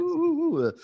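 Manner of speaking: high excited squeals of delight; squealing hooting oohs; ecstatic oohs. Retold or imitated. Imitating the phone dopamine rush. (ouh ouh extatiques)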